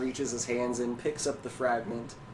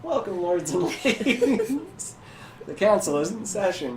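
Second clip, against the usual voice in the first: welcoming council host; 9 semitones higher, 3 dB louder than usual. Acted. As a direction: play a host formally receiving guests. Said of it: "and he goes".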